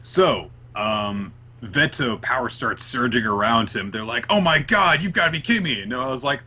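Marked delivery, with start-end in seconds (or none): 4.3-5.8 higher, exasperated disbelief; mock dismay. Host acts out the team seeing Veto revive, mock dismay. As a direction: protest in hammed-up disbelief.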